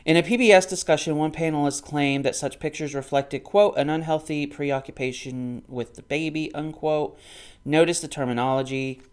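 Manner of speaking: fast, loud, high reading with quoted bits; book read-aloud. Acted. Vlogger reads the PBS passage from Randy's book, in a loud reading voice. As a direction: read it out like a recited argument.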